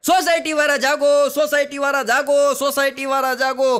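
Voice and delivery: high, loud alarm call to the whole block. Retold or imitated. Society secretary yelling to wake the residents, high loud call. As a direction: rouse sleeping neighbours at 3 am. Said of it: "secretary રાડો રાડ થયા".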